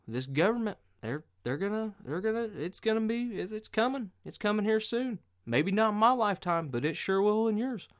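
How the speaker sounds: doomsday crank, rising in pitch and urgency; old-time Vietnam veteran. Retold or imitated. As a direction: warn the boys, building to high, loud alarm. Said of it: "Some old-time Vietnam guy".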